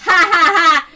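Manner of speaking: loud ha ha ha gloat; villain laugh. Acted. Schemer announcing her plan, loud gloating laugh.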